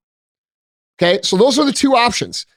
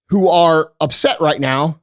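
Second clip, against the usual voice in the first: ordinary talk, nothing marked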